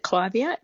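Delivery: "Clivia" with a long i, KLY-vee-uh; first syllable of "Clivia" like "climb"; long-i pronunciation. Shown as a demonstration. Gardener giving her own version, "Clivia" as KLY-vee-uh. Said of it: "so I, I pronounce it".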